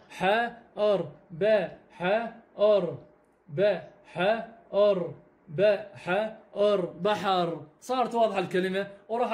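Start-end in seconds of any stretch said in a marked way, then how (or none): 1.9-7.7 very slow letter-by-letter بحر; slow spelling out. Shown as a demonstration. Teacher spelling out a word for pupils, very slowly. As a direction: sound out ب ح ر slowly, then join into بحر. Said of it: "او نقول ح".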